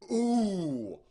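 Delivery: long high "ooh", very loud; big reaction cry; excited whoop. Real, not acted. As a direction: howl at the cliffhanger, falling from the top. Reacts to cliffhanger ending.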